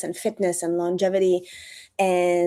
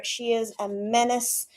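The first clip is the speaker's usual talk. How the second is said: ordinary delivery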